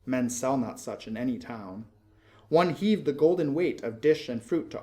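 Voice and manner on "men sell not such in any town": usual speaking voice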